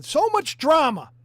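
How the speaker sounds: loud, exasperated sigh of a line; so fed up with it all, loud. Real, not acted. Vlogger fed up with drama, loud exasperation.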